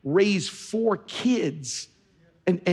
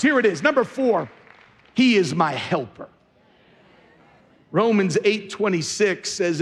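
normal talking voice

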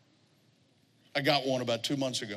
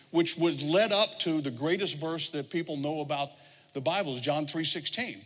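ordinary delivery

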